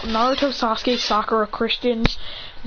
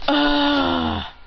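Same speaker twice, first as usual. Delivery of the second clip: long, drawn-out higher-pitched "ah"; loud sigh; 5 semitones higher than usual. Acted. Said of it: "a loud sigh".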